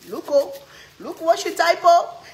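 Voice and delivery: loud, high excited outburst. Real, not acted. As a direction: burst out at what she typed. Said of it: "excited reaction to comment".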